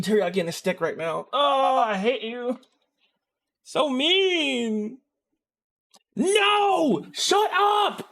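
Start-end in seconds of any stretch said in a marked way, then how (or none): 1.3-5.0 high, drawn-out pouty complaint; mock-hurt whine. Hungry streamer to teasing viewers, playfully wounded. 6.2-8.1 very high, drawn-out wailing protest; mock tantrum. Hungry streamer fending off food suggestions, mock tantrum.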